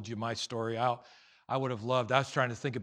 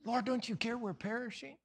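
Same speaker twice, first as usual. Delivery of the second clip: high, softer plea; pleading; 9 semitones higher, 7 dB quieter than usual. Acted. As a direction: plead in a raised, worried voice. Voicing the panicked disciples' plea.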